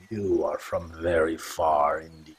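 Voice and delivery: low, soft, grave remark; impressed by a foreign bow, voiced low; tabaxi elder voice. The tabaxi speaks in voice.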